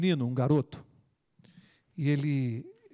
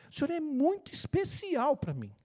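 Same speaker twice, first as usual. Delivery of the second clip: put-on sincere little boy; 9 semitones higher than usual. Boy's sincere words repeated.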